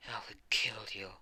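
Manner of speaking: a villain hissing a death promise; menacing whisper. Acted. Villain whispers a death threat to the hero. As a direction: whisper the threat, close and cold.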